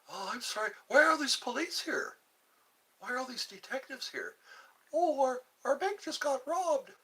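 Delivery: high, chirpy clueless voice; oblivious customer. Retold or imitated. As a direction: play the cheerful bystander who missed it. Imitating oblivious late bank customer.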